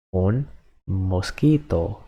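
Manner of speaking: Un mosquito said slowly and softly, each syllable clear; slow teaching repeat. Shown as a demonstration. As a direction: spell out Un mosquito slowly for the learner.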